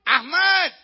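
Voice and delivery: high, loud name call; acted shout to someone far off. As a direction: yell the name across the border. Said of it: "dia teriak aja gitu".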